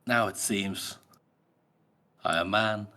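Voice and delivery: halting lines with long pauses; pensive. Acted. Killer summing up what he has become, understated pensive delivery. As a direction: muse gravely, leaving space between phrases. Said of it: "He's just so pensive here".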